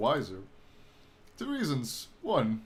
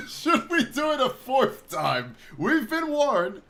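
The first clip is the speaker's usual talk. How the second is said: higher, slow teasing menace; hammed-up ominous tease; mock ominous; 9 semitones higher, 5 dB louder, slower than usual. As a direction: drawl it out mischievously.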